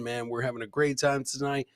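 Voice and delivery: ordinary talk, nothing marked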